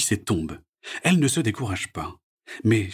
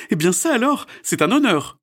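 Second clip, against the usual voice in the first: high, loud, smiling; pleased and flattered; smiling Sophie; 14 semitones higher, 6 dB louder than usual. A narrator's reading. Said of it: "sourit Sophie". (voix souriante de Sophie)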